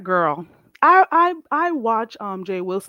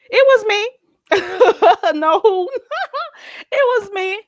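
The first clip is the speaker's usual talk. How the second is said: laughing; 13 semitones higher, 7 dB louder than usual. Real, not acted. Gleeful confession amid laughs.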